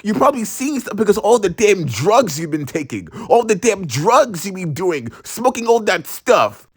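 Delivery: high-pitched mock-mom rant; scolding mom. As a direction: imitate an exasperated mother accusing her son. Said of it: "And she was like".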